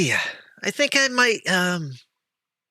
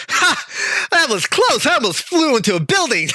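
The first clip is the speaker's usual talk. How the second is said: relieved excited laugh. Acted. Bird shouting to the party after a narrow dodge, high laughing relief. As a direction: cackle at the near miss, fast. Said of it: "She goes".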